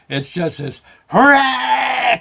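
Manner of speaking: high, slow mock howl of escaping steam; drawing out the roar of steam; steam howl imitation. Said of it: "it gets your attention".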